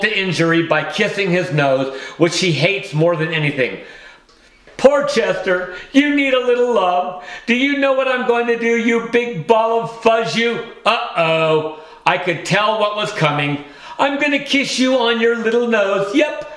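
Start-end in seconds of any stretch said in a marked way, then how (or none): 4.8-10.6 put-on higher, fast baby talk to a cat; cooing cat owner. Mrs Monroe coos to cat. 14.0-16.5 put-on higher, fast baby talk to a cat; cooing cat owner. Mrs Monroe coos to cat.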